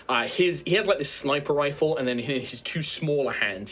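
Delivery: usual speaking voice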